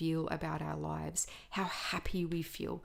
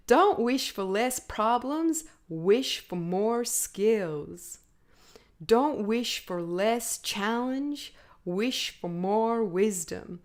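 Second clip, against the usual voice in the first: imitating an American speaker, higher, louder and slow; Jim Rohn's accent; 3 semitones higher, 6 dB louder, slower than usual. Podcast host quotes Jim Rohn to listeners in his accent. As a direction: deliver each line slowly like a stage speaker. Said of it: "he says it in such a beautiful accent".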